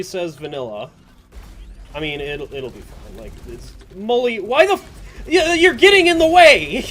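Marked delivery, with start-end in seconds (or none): 3.9-6.8 exasperated shout at a teammate; yelling. Real, not acted. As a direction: yell it out, really annoyed. Yelling at teammate blocking him.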